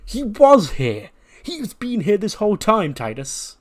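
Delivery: high, slow, disgusted complaint. Retold or imitated. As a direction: sound appalled he was here all along. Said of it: "looking disgusted".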